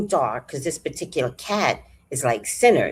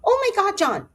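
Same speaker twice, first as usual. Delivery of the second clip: very high alarmed cry to John; the speaker alarmed, crying out to John very high. Retold or imitated.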